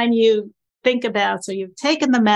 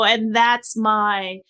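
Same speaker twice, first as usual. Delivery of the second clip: ordinary talk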